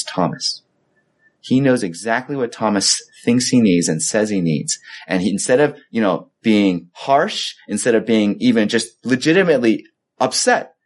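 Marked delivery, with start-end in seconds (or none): none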